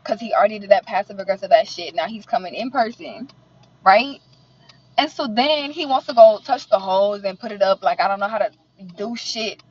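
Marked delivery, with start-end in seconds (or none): none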